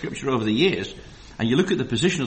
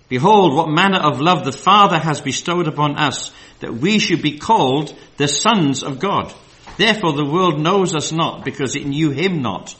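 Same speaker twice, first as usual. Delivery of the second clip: scripture reading; 2 semitones higher, 6 dB louder than usual. Acted. Reads scripture aloud.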